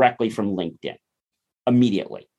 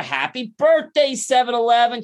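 excited birthday shout-out; 10 semitones higher than usual. Acted. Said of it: "I'm like".

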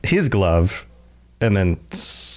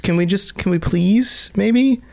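high-pitched pleading, slowing on "please maybe"; plead, voice raised in pitch; exasperated plea; 10 semitones higher than usual. Real, not acted. Pleads for humans to stop.

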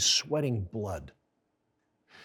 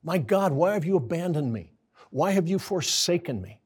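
higher, urgent cry of abandonment; Jesus on the cross, anguished and raised; 4 semitones higher than usual. Retold or imitated. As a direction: plead as one forsaken. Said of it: "Jesus feels as though God is absent".

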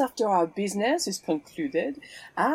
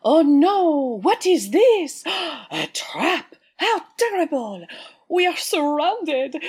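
over-the-top melodramatic wailing, high and loud; hammy stage actor; 8 semitones higher, 6 dB louder than usual. Acted. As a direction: ham up a fake panic like a bad stage actor. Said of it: "next time hire a higher caliber of actor".

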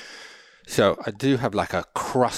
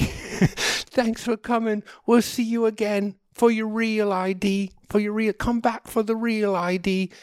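imitated office workers seeing someone off; waving well-wisher; 9 semitones higher than usual. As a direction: call after him in a put-on high voice. Imitating DMV staff waving goodbye.

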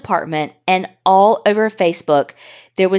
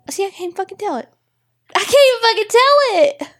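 high, choked voice breaking into tears; trying to talk through real tears, high and strained; crying; 13 semitones higher than usual. Real, not acted. Host crying while telling about a TikTok, voice breaking. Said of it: "I cried even so hard just then".

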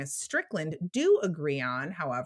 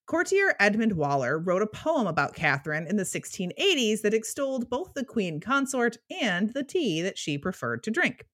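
usual speaking voice

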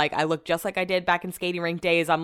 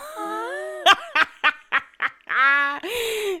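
high, helpless laugh near tears; burst of laughter. Real, not acted. Laughing at her own fib to the nail tech, helpless laughter. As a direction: crack up at the story.

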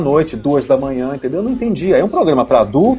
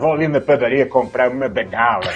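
put-on old Cosmo voice; 3 semitones lower than usual. Voicing Cosmo in old-man costume.

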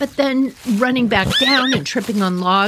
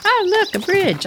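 delighted horse; 12 semitones higher than usual. Retold or imitated. Horse spotting a turn-off, playfully excited and high. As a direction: pipe up like a horse spotting something.

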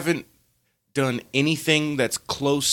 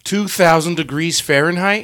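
loud, higher, questioning repeat; raised, disbelieving question; incredulous echo; 5 semitones higher, 6 dB louder than usual. Pretend. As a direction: echo it back in astonishment. Mock amazed echo of the story.

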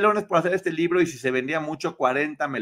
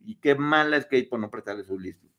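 ordinary talk, nothing marked

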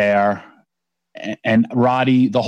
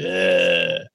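long, low mock groan; dazed groan; 5 semitones lower, 3 dB quieter than usual. Dazed man's groan, acted out.

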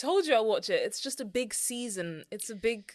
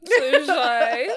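louder, cracking up; laughing squeal; 3 semitones higher, 11 dB louder than usual. Real, not acted. Host admits she's shy, laughing loudly. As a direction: squeal it through embarrassed laughter.

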